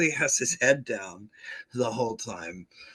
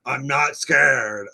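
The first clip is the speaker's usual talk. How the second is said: slow, louder, deliberate I'm not scared; mock insistence; 2 semitones higher, 7 dB louder, slower than usual. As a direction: spell out each word to convince yourself. [name] insisting after door slams.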